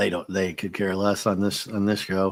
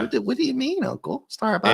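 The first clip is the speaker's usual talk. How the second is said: put-on much higher, puzzled little kid; childlike Boxy voice. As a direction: innocent boy, confused by the question. Voicing Boxy's innocent reply.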